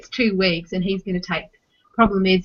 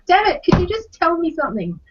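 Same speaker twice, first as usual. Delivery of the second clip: high, pleading mock frustration; half-joking plea to a toddler who won't talk; mock exasperated plea; 9 semitones higher than usual. Frustrated mum to silent toddler.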